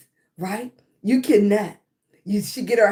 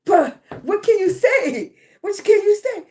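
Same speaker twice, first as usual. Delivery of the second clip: amused disbelief; 8 semitones higher, 5 dB louder than usual. Retold or imitated. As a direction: throw up your hands, high and loud. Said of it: "I was like".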